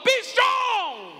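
high, loud shouted cheer. Shown as a demonstration. Pastor leading the congregation, shouting like a fan. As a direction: yell it like rooting for your team. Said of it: "Say it loud".

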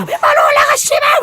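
angry shout. Retold or imitated. Senior student yells at freshmen for wearing stage shoes, angry shout. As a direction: bark it like a furious upperclassman. Retold seniors yelling at juniors.

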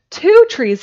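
loud, high shout of joy; boy shouting over his presents. A narrator's reading. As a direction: yell it out in wild excitement. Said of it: "he shouted".

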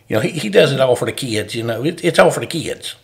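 a hint of a South Alabama football coach accent. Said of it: "I can fall into that accent with him".

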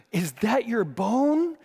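slowed, stunned question about the bone; alarmed question. Retold or imitated. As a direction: ask it like you can't believe your eyes. Co-leader sees exposed bone.

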